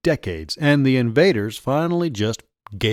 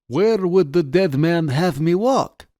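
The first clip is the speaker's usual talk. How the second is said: put-on slow, high Russian-accented question; 7 semitones higher, slower than usual. A narrator's reading. Party officer challenges his captor, in a Russian accent. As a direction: ask slowly in a Russian accent. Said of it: "he asked in a heavy Russian accent".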